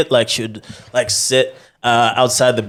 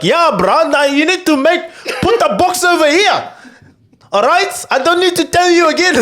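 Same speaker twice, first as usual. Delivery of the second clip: high, laughing mock South African shout at aliens; a space villain bossing aliens in a South African accent, higher and laughing; South African villain yelling. Movie bad guy barks orders at aliens, South African accent, high and laughing. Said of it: "some dude yelling at aliens".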